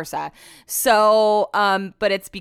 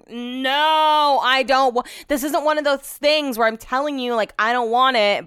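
exasperated refusal; 5 semitones higher, 4 dB louder, slower than usual. Retold or imitated. Exasperated refusal in retold spat.

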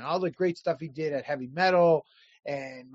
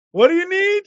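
high, loud eager question; mock eagerness; 10 semitones higher, 8 dB louder than usual. Creators who suddenly go looking for work, mock eagerness. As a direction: pitch it up high and loud, imitating sudden keenness.

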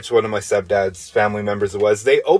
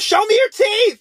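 very high mock-hysterical demand; wailing mother. Mom demands to inspect her son's teeth, mock wailing. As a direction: mimic a frantic mom's wail. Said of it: "just yells, wails like".

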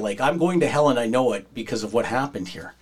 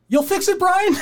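very high, louder disbelieving question; mock the hurt guy's stunned doubt; incredulous squeak; 4 dB louder than usual. Injured wrestler asking the smoking vet if he'll fix it, high incredulous. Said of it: "Tulip's like".

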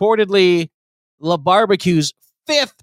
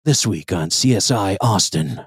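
low, dramatic trailer-voice tease; crime show announcer; 10 semitones lower than usual. Pretend. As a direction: intone it like a TV promo.

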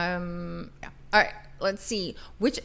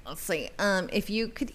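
normal talking voice

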